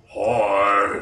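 Cookie Monster hybrid. Retold or imitated. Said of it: "Cookie Monster crossed with something else".

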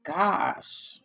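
slow, appalled oh my gosh; slow disbelieving oh my gosh to herself; shocked oh my gosh. Retold or imitated. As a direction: drawl it in disbelief. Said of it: "I'm like".

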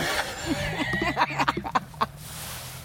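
airy unvoiced laugh at a friend; breathy laugh. Real, not acted. Laughing at a friend's dancing, breathy laugh. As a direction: let out a soft breathy laugh.

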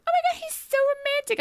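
very high, gushing mock swoon. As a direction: coo it like a starstruck crowd. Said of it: "people around me were like".

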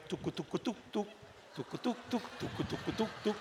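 soft rhythmic coffee-pot tuks; quiet tuk tuk percolating; percolator sound. Shown as a demonstration. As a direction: imitate a percolator bubbling. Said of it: "that coffee commercial, Sanka".

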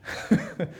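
a short high laugh at his own joke; high amused laugh. Real, not acted. Laughs at the spies' quote.

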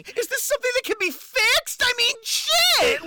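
high-pitched mock desperate plea; panicked pleading. Panicked Scrooge pleading, played for laughs.

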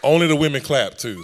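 loud, teasing, stress on only; playful loud call-out. Real, not acted. Teasing the audience about clapping.

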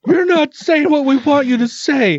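high laughing mock voice; laughing mock whine. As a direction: squeal it through laughter, whining. Mocking Mormons, laughing.

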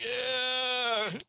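reluctant. Retold or imitated. Retold drawn-out reluctant noise.

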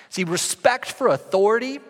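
usual speaking voice

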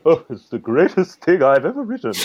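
high, pompous self-praise; mock boastful. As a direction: brag it with fake grandeur. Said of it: "that's part of the problem of this sort of".

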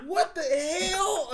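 high, drawn-out mock outrage; exasperated disbelief. Storyteller reliving waking up still parked, exasperated disbelief. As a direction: stretch it out in high-pitched disbelief.

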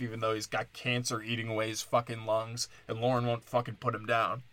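ordinary talk, nothing marked

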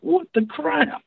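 high, slow baffled exclamation; drawn-out what-the-heck disbelief; stretched out in shock; surprised. Retold or imitated. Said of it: "I'm like".

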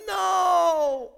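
lightly voiced child, high and loud; a hint of a stubborn little boy; son refusing. Pastor imitating his son refusing to leave, high and loud.

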